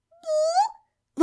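very high, drawn-out creature squeak; joyful exclamation. A narrator's reading. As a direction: squeal it happily like a tiny pet. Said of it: "воскликнул Шарик радостно". (радостный тонкий писк зверька)

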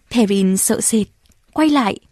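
plain reading voice

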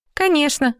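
high, dismissive snort of a yes. Acted. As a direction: scoff it out, high pitched. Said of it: "фыркнула девушка". (фыркая)

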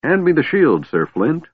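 high-pitched call for the shield; eager yell. A narrator's reading. Kender calls to the dwarf for a shield, yelling. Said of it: "the Kender yelled".

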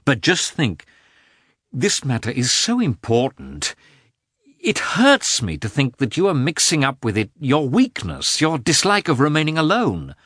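exasperated husband reasoning with his wife; urgent pleading. A narrator's reading. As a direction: press the point earnestly, voice climbing. Husband pleads and argues in quarrel.

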